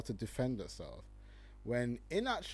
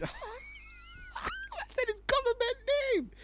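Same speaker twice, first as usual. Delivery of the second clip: laughing; much slower than usual. Real, not acted. Laughing at the name.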